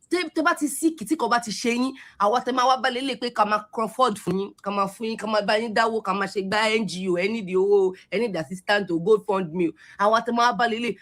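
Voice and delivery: usual speaking voice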